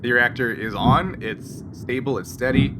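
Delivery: usual speaking voice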